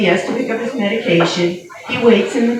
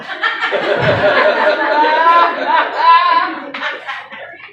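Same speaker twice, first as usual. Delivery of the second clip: big high laugh; 9 semitones higher than usual. Real, not acted. Comic cracking up at her own birds joke.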